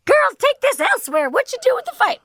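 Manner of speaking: very high, loud imitation of a woman shouting; scolding bystander. Woman in the back scolds fighting girls, mock yell. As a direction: holler it as a put-on scolding woman.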